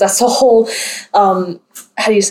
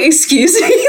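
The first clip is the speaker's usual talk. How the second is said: retold high-pitched, incredulous comeback; taken aback; 8 semitones higher, slower than usual. Student to rude classmates in the auditorium, taken aback. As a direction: lift it high into an offended question. Retells her indignant reply to bullies.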